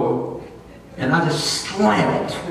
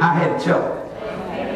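usual speaking voice

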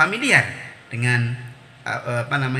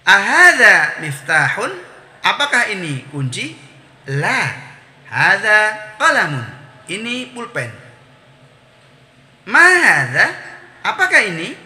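higher, slow, melodic teacher recitation; question-and-answer drill; 4 semitones higher, 5 dB louder, slower than usual. Shown as a demonstration. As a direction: model each phrase with strong questioning and answering tunes.

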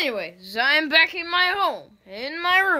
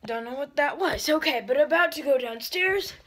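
normal talking voice

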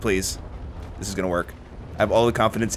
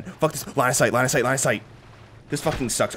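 ordinary talk

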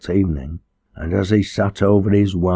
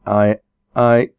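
stammering; 3 semitones higher, 5 dB louder than usual. A narrator's reading. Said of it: "he stammered".

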